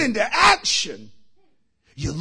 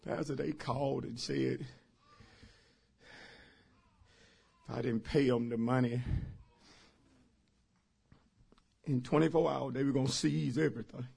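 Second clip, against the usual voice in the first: low, soft, halting voice of a distraught member; mock tearful confession; 8 semitones lower, 14 dB quieter, faster than usual. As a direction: confess it meekly to the pastor. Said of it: "I'm sorry for crying".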